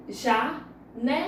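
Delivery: "Janela" slowly broken into ja... ne... la; "Janela" with a pause after ja and ne; syllable by syllable. Shown as a demonstration. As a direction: count out "Janela" in three separated syllables. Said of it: "Quantas sílabas tem?".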